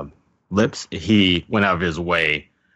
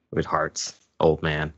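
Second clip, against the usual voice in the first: ordinary talk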